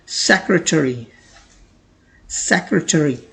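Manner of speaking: secretary as "sek-re-te-ri", slow and clear; correct pronunciation. Shown as a demonstration. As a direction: model secretary syllable by syllable. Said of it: "इसकी correct pronunciation होती है". (secretary का सही उच्चारण)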